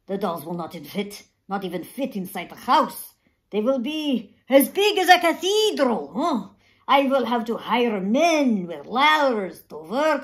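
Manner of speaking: put-on exasperated old craftsman ranting; exasperated grumbling. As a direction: ham up the despair. Grumbling about endless doll boxes.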